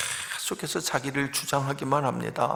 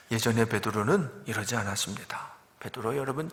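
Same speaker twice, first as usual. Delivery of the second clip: ordinary talk, nothing marked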